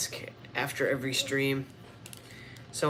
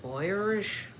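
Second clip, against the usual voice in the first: put-on Irish accent, rising high; 6 semitones higher than usual. Streamer playing with accents, mock Irish accent. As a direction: try a playful Irish lilt. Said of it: "I did Irish".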